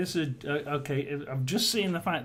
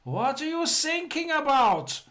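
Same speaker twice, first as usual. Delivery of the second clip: much higher puzzled yell in a put-on voice; officer shouting back across the sea; shouted reply; 12 semitones higher, 4 dB louder than usual. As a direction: bellow the punchline back, baffled. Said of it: "the other German officer shouts".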